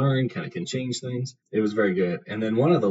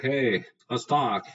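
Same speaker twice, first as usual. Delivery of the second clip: higher, slow goblin drawl; slow sly goblin voice; 4 semitones higher, slower than usual. Retold or imitated. Goblin calling a man to the fence, slow and sly. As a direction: lure him in like a sly goblin. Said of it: "the goblin's like".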